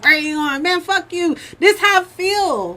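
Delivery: hammed-up furious boyfriend; mock angry man. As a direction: ex boyfriend yelling as she leaves, mock angry. Voicing angry ex.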